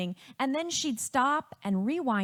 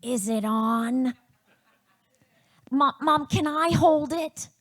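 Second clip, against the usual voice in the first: put-on eager child voice, louder and pressing; Andrea; 2 semitones higher, 6 dB louder than usual. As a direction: pester mom like an impatient little girl. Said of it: "my older sister, Andrea, say".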